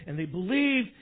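ordinary delivery

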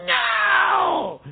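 put-on high, loud childish "No!" held out; toddler's defiant no. Pastor voicing a little child refusing the parent, high held no. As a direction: bark it up at the parent like a small child. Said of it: "this little thing to look at this big adult and go".

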